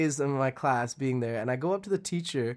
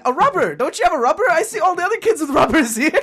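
put-on much higher, louder, half-laughing like a bewildered little kid; 9 dB louder, faster than usual. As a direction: squeak it out as a confused child through giggles. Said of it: "I'm like".

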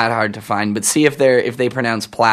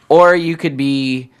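normal talking voice

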